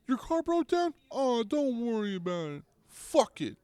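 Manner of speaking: very high cartoon mascot, cheerfully dismissive; squeaky high shrug-it-off buddy; Grimace voice. Grimace telling a customer to forget the broken car, very high cartoon voice. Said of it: "He's like".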